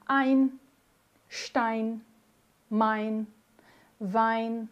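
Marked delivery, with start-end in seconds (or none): none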